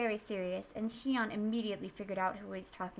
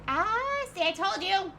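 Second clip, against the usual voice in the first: gloating; 8 semitones higher, 6 dB louder, slower than usual. Acted. Teasing gloat in character.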